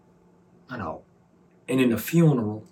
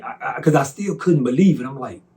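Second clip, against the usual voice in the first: ordinary talk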